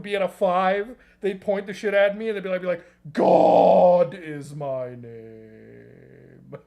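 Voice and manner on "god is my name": very slow, deep and loud booming answer; trolling ghost hunters as a booming fake God; mock ghostly God voice. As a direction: drag out each word like a spooky deity.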